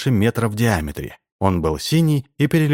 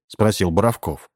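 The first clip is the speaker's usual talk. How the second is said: ordinary delivery, nothing marked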